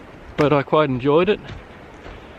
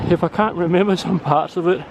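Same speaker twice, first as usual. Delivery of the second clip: usual speaking voice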